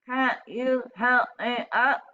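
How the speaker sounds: pleading for help. Acted. Upside-down Napoleon asks for help, slow plea. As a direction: ask for help plaintively. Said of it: "said Napoleon".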